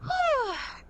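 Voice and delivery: long sigh, starting high and falling; high relieved sigh. Acted. Guide sighing with relief as they leave the dark chamber, high and falling. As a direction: let the relief out in one high falling breath. Finally out of the trial.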